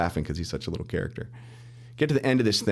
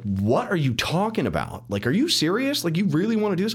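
incredulous; 10 semitones higher, 3 dB louder than usual. Retold or imitated. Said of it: "people started getting pissed".

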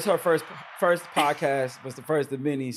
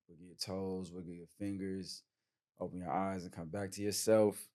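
calm grounding exercise. Acted. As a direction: lead them gently into calm breathing. Leads breathing meditation.

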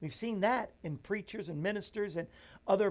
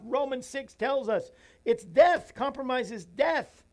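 fervent preaching; 6 semitones higher, 7 dB louder than usual. Real, not acted. Preaching at full force.

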